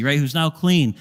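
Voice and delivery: usual speaking voice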